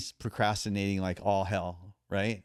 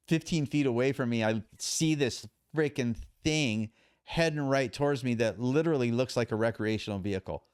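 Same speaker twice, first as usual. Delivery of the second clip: ordinary delivery, nothing marked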